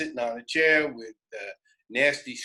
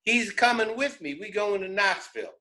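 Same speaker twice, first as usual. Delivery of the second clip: higher country voice; raised-pitch hillbilly explaining; Clarence explaining; 8 semitones higher than usual. Retold or imitated. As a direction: introduce your buddy casually. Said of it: "He said".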